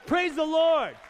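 high, very loud praise cry; exultant shout. Real, not acted. Preacher cheering the prophecy, exultant shout.

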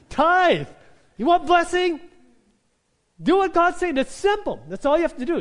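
loud, high, fervent preaching burst; preaching at full force. Acted. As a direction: thunder the tithing call at the congregation. Preaching climax on tithing.

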